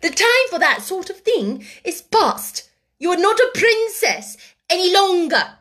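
high, loud, emphatic decree; severe scolding. A narrator's reading. Headmistress strips the pupil of privileges, severely. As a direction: hammer out each new rule. Headmistress lays down new rules.

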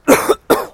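dry rasping cough; chesty cough. Real, not acted. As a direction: cough from the tail end of flu. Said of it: "scusate per la tosse".

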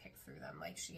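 soft unvoiced whisper. Real, not acted. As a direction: breathe it out as a whisper. Wistful aside about candy molds.

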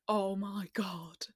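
slow, breathless whispered disbelief; awed whisper. Retold or imitated. Whispering in shock at her first offer. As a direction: breathe it out in stunned awe.

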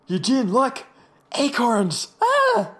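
high, loud, hammed-up discovery; mock excited shriek. Friend mocking Eugene's silly discovery, exaggerated excited cries. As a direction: squeal it with exaggerated amazement, rising to a cry.

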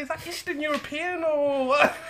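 high-pitched, drawn-out retold question through laughter; laughing disbelief. Recalling hearing a Liverpudlian girl speak, high laughing bafflement.

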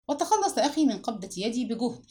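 ordinary delivery

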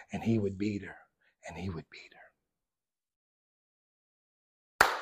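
hushed, breathy whisper of the beating; whispered aside to the congregation. Acted. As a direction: drop it to a secret whisper.

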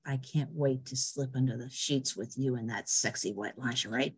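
low, soft mock-seductive murmur; sexy voice. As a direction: purr it like a hammed-up seducer. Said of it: "he says in a very sexy voice".

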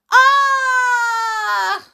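high, long, loud shriek; sustained scream of pain. A narrator's reading. Boy with smoking pyjamas, screaming. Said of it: "screamed Tom".

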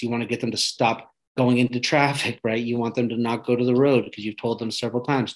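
ordinary talk

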